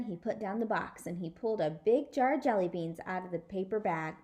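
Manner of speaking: ordinary delivery, nothing marked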